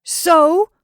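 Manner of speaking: high, loud exasperated query; highly exasperated. A narrator's reading. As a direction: throw it back in exasperation. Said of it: "highly exasperated".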